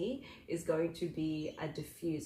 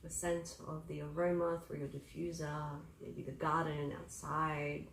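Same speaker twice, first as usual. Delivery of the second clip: guided meditation; 6 dB quieter than usual. Acted.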